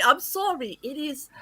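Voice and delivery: normal talking voice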